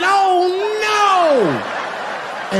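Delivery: high, loud comic shriek; mock horror. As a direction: shriek in hammed-up panic. Seeing the knuckles, shocked.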